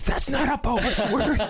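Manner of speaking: high and laughing through it; mother-in-law laughing at the toddler's swear. Retold or imitated. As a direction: crack up while quoting her. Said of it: "She goes".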